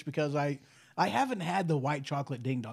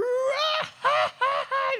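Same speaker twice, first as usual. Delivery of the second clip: crazy high squeal; 4 dB louder than usual. Retold or imitated. Imitating the mad cow.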